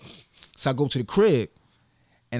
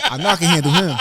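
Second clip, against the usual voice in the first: louder, laughing through the words; laughing retelling; 3 semitones higher, 7 dB louder than usual. Retold or imitated. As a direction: chuckle it out as the cocky kid he was. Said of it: "I said".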